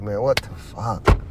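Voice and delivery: low, slow, fed-up "what the fuck"; exasperated outburst. Retold or imitated. Preacher voicing the viewer's disgust at the world, low and drawn out. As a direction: drop low and drag it out in disbelief. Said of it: "get mad and be like".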